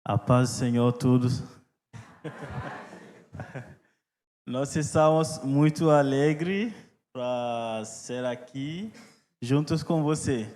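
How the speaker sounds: Portuguese with a foreign accent; careful learner's Portuguese, foreign accent; english accent portuguese. Real, not acted. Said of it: "eu tô aprendendo português".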